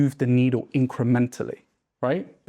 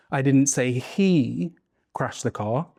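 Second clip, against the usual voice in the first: he held long, slow, then a pause; stress on HE; slower than usual. Shown as a demonstration. Coach shows the class stress landing on he. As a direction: linger on he. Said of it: "If you emphasize different words".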